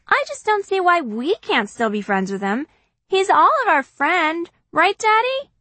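put-on high pleading kid voice; little girl voice. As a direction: coax Daddy like a little sister. Narrator voices Kitty.

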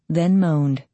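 plain reading voice

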